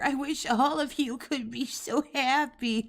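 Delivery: high, sappy mock sincerity; sneering high-pitched imitation of a sentimental wish; mocking quote. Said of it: "he just gets fucking weird right here".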